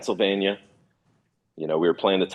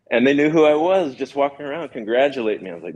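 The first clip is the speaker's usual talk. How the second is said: ordinary talk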